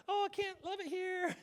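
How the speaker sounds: high, airy put-on voice of a show-off; mock bragging poster. A show-off on the beach, high put-on voice. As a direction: chirp it like a smug beach poster.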